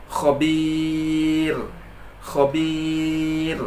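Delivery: khobir with a thin final r, recited slowly. Shown as a demonstration. Teacher models khobir, thin ra. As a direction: recite khobir lightly, no heavy r. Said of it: "Tak boleh dibaca tebal ra tadi".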